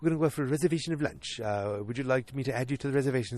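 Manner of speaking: lightly British, quick and prim; a hint of a British office manager, brisk; mild mock-British Lane Price offering lunch; Lane Price impression. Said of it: "This is my Lane Price".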